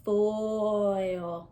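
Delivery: foil drawn out slowly, tin dropped; deleted syllable. Shown as a demonstration. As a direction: drop tin and stretch foil. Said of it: "throw the tin".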